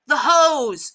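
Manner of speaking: loud, high urgent shout. A narrator's reading. Bystander wants the dogs separated, shouting. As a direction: shout out the remedy. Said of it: "someone shouted".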